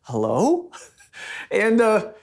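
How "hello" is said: HELLO low, then sliding up as a question. Retold or imitated. Husband imitates his wife answering his call, questioning. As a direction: imitate not knowing who's on the line on HELLO. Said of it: "act like you don't know".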